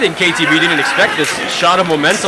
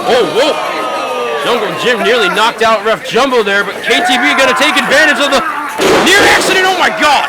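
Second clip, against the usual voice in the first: excited shouting; 6 semitones higher than usual. Real, not acted. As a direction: yell it out as it happens. Near accident in the ring.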